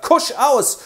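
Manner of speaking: loud, high barked order; sharp command. Acted. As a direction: stop the scuffle. Fürst geht dazwischen.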